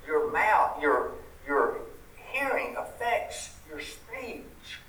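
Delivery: normal talking voice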